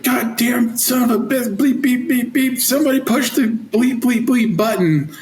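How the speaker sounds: high, excited mock cursing; swearing gunny. Old gunny yells about the alarm, mock cursing. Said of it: "he's like".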